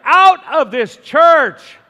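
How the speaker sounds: loud, high, slow shout; angry priest shouting. Retold or imitated. Priest catching the pastor in the confessional, yelling. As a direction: throw him out with a roar. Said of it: "he caught me in there".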